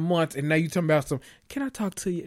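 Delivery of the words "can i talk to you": put-on baby doll voice. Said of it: "that little baby doll voice".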